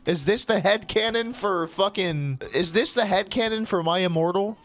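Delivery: real baffled exclamation; incredulous outburst. Real, not acted. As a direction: shout the question in amazement. Shocked reaction to story.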